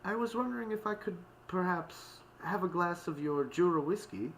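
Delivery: high-pitched, airy, mannered request; polite lady's lilting request. A narrator's reading. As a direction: ask very politely, pitched well up. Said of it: "her most airy and polite tone".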